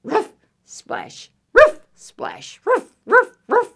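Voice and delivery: short high ruffs with splashes; yappy, high-pitched ruffs; dog bark. Acted. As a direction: bark like a small dog in water. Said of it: "And dog says".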